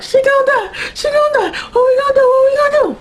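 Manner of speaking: very high mock-panicked squeal; Tara. Tara at the hospital as Sookie is dying, hammed-up panic. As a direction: imitate a friend shrieking in panic.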